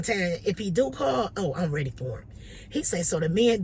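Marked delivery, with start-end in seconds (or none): none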